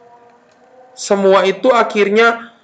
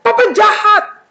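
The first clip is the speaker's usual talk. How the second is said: high, loud whiny protest; bratty child refusal; 3 dB louder than usual. Acted. Child talks back to parents, whiny and defiant. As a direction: throw a small mock tantrum. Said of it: "jangan bilang gini".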